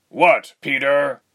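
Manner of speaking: loud, high, drawn-out retort; terse. A narrator's reading. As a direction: throw it back tersely and loudly. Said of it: "he replied tersely".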